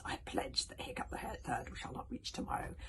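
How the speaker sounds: loud, threatening dragon voice; vengeful dragon vow; menacing dragon. Acted. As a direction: swear to hunt him down.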